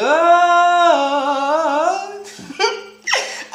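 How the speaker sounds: high sing-song laugh, giddy; excited sing-song laughter; giggly laugh. Real, not acted.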